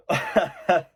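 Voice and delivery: high-pitched burst of laughter; incredulous laugh. Real, not acted. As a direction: laugh out loud in disbelief. Scoffing at manager's complaint.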